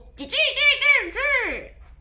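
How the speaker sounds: high, loud cackling giggle; giggling fit. Real, not acted. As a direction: burst out giggling. Laughing at the story.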